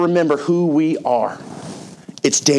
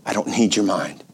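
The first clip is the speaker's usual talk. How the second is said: voice of God; 7 semitones lower, 5 dB quieter than usual. Retold or imitated. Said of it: "And God says".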